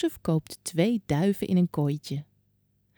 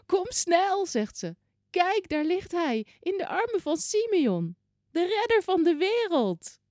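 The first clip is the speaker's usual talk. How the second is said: put-on high, quavering old woman calling out; trembling call; 11 semitones higher than usual. Old Hanna calling the people in the temple, high and trembling. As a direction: call the crowd over in a shaky old voice.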